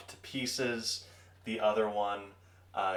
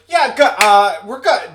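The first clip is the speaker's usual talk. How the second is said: high, loud, flustered brush-off; nervous Gear Boys; 12 semitones higher, 19 dB louder than usual. Acted. Gear Boys brushing off the knight, high loud nervous. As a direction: hurry them along, loud and nervous.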